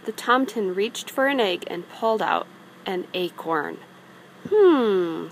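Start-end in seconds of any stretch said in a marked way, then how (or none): 4.5-5.3 loud puzzled hum; a loud thinking hmm; Tomten's puzzled hum. A narrator's reading. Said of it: "said the Tomten".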